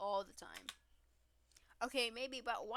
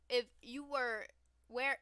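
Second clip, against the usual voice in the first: ordinary talk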